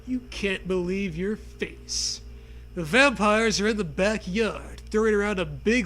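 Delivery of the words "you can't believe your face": ordinary talk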